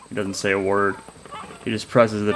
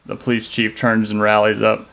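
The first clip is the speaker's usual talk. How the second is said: ordinary delivery, nothing marked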